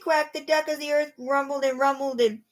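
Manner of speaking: plain reading voice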